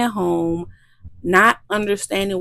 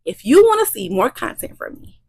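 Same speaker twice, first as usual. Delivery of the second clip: ordinary talk, nothing marked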